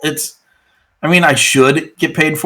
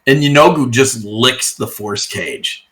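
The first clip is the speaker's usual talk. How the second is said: usual speaking voice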